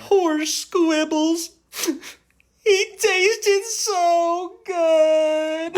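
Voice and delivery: high, very drawn-out lament; mock mourning. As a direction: wail slowly for the eaten pet. Mourning the eaten animal companion.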